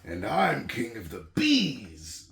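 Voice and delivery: loud, high, slow, crowing boast; gloating turtle king. Acted. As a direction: revel in being on top. Yertle gloating at the top.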